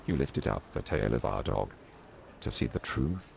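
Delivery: low, puzzled cop voice; doubtful policeman. A narrator's reading. Policeman doubting the boy's answer, low and puzzled. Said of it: "the policeman asked doubtfully".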